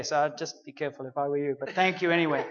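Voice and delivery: low, soft, quick and wry; a low, knowing warning; dry God voice. Retold or imitated.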